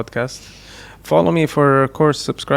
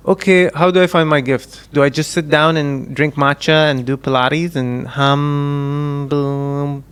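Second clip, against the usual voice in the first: mock seeker; 2 semitones higher, 3 dB louder, much slower than usual. Podcast host mocking an imagined listener, hammed-up. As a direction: play a lost soul asking how to find her gift.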